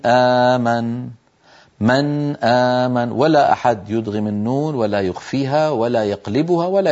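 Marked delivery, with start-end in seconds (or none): 0.1-3.1 slow من آمن with the ن of من fully pronounced; clear nun. Shown as a demonstration. Teacher demonstrating izhar in من آمن. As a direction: stretch من آمن, ن sounded before the hamza. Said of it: "يظهرون النون عند الهمزة فيقولون".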